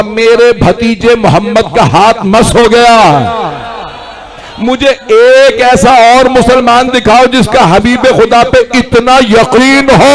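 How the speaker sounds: impassioned sermon climax; full-force preaching. Real, not acted. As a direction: challenge the crowd at rising volume.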